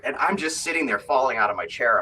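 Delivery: ordinary talk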